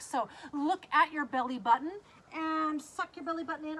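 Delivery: ordinary talk, nothing marked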